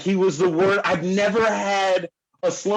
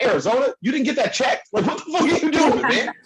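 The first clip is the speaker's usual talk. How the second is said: much higher, laughing disbelief at a friend's carelessness; mock outrage with a laugh breaking through, pitched way up; incredulous disbelief; 10 semitones higher than usual. Incredulous at friend's car neglect.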